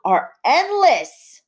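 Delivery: high, slow and drawn out for effect; dramatic exaggeration. Shown as a demonstration. Teacher reading a student's hyperbole aloud, drawn-out and dramatic. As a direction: stretch it out like it's endless. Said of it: "she's exaggerating".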